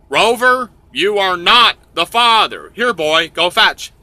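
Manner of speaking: lightly hammed host announcement; show host verdict. Host gives verdict to a dog.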